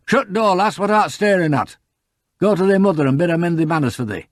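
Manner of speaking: put-on high-pitched, dialect-speaking farmer ordering a child off; gruff scolding. A narrator's reading. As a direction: scold the lass and send her packing.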